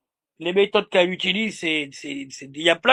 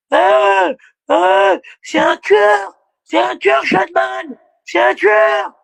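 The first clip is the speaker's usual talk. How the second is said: mock hysterical yelling; 10 semitones higher, much slower than usual. Streamer imitating the woman yelling at Mike, high mock shout. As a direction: scream like she's losing it. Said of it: "elle hurlait".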